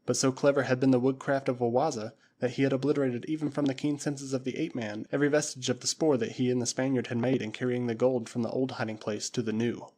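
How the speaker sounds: ordinary delivery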